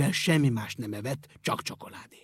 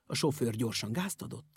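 ordinary delivery, nothing marked

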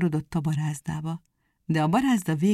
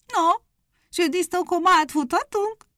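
impatient hare; 12 semitones higher, 4 dB louder than usual. Acted.

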